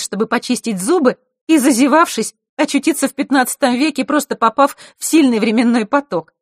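high, loud animated telling; lively girl storyteller. Acted. Scene: Zakhara telling her guest a funny time-travel tale, high and loud. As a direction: spin the tale with relish.

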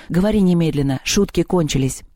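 ordinary delivery, nothing marked

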